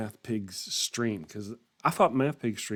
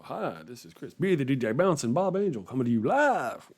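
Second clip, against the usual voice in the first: bouncy sing-song broadcast patter, higher on the sign-off; morning show host; 3 semitones higher than usual. Acted. Streamer expected to go live in the morning, hammed-up host patter. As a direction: parody a chirpy live-stream intro. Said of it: "is expected to go".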